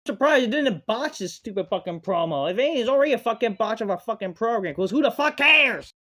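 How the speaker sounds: loud, high tirade building to a yell; exasperated outburst, climbing; angry rant. Real, not acted. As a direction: rant hard, then shout the last question.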